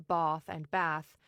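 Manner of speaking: first "bath" as "bahth", second "bath" with short American "a"; switching bath vowels. Shown as a demonstration. Teacher showing Hepburn's switch, "bath" first broad "ah", then short "a". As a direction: slowly contrast broad "bahth" with flat "bath". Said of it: "she often switches between the two".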